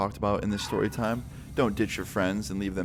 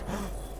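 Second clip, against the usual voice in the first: quick breathy unvoiced gasp of alarm; a soft startled inhale; sharp gasp; 11 dB quieter than usual. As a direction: suck in air in sudden panic. Realizing she knows he's high.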